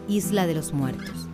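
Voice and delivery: ordinary talk, nothing marked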